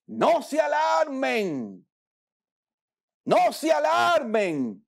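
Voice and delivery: slow, high call to the crowd; calling out slowly. Shown as a demonstration. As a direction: call them to stay calm, drawn out. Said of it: "¿Cómo fue que dijo?". (grito lento y agudo: no se alarmen)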